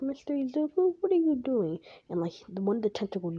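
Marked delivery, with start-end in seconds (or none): 0.0-1.8 put-on high, childlike little girl asking. Little Eri asking what he is doing, high and childlike. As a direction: lift it up like a small girl calling out. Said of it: "she's like".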